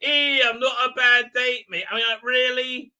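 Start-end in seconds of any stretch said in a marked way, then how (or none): 0.0-1.8 high, loud mock of "Eh, I'm not a bad date, me"; mocking her line in a loud, high put-on voice; mock quote. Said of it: "exactly what she said".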